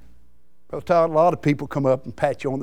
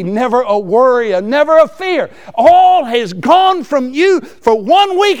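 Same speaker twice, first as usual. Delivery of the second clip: building preaching cry; 7 semitones higher, 7 dB louder, slower than usual. Acted. Sermon climax building.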